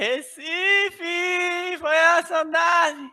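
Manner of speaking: high, drawn-out joyful cry; excited shout. Pretend. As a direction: shout it like arriving home. Arrival shout after boarding joke.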